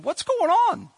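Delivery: high, bewildered cry; bewildered Peter. Retold or imitated. Peter stunned by the heavenly vision, crying out bewildered. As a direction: squeak it out in total confusion.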